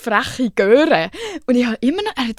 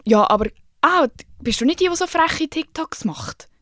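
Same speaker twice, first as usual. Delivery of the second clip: quoting the judge; 8 semitones higher than usual. Retold or imitated. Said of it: "plötzlich aus dem Nichts sagt er".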